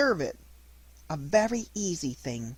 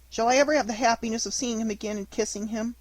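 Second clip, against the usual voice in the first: higher, hurried hopeful question; yearning child's question, quick and raised; longing hope; 6 semitones higher, faster than usual. Acted. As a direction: let the hope spill out quickly. Pinocchio longing for papa.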